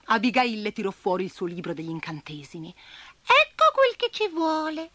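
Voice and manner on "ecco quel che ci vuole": spider voice. A narrator's reading. Abigail finds spell.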